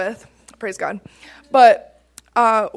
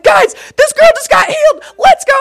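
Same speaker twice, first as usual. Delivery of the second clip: very high, loud excited shouting; freaking out; 8 dB louder than usual. Retold or imitated. Praying woman announcing a healing to friends, excited retold shout. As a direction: shout it to the whole room, voice way up. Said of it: "'cause I was freaking out".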